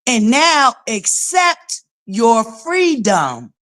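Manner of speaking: slow, deliberate reading of the letter; measured public reading; slow read-aloud. Shown as a demonstration. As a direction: read it out word by word. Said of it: "Let's read that together".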